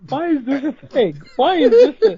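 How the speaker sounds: high, rising-loud why is this a thing; exasperated disbelief. Real, not acted. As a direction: plead through laughter at the absurdity. Reacting to absurd joke.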